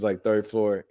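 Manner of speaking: ordinary delivery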